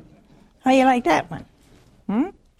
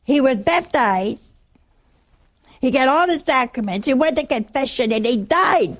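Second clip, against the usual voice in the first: imitated widow's raised, indignant rant; louder, higher mock outrage; getting hot; 4 semitones higher, 5 dB louder than usual. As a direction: rattle off grievances as a heated widow. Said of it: "boy, she's getting hot".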